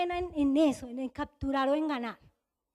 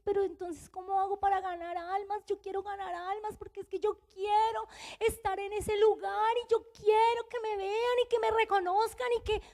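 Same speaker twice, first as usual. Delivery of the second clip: imitated tearful pleading; 5 semitones higher, 5 dB quieter than usual. Woman crying to God about winning souls, imitated tearful pleading. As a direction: whine it like an acted crying prayer. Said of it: "Y yo lloraba y yo decía".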